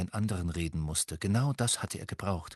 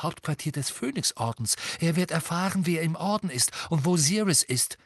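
panic; 7 semitones higher than usual. A narrator's reading. Harry fears he is possessed and betraying the Order, panicking. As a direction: start in breathless horror, build to an urgent high pitch. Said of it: "in einem fürchterlichen Anflug von Panik".

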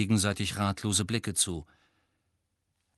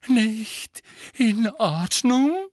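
high, loud and slow, shaking with agitation; teacher indignantly repeats a student's question; trembling agitation; 11 semitones higher, 6 dB louder, much slower than usual. Acted. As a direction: throw the question back, indignant. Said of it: "ihre Stimme bebte vor Erregung".

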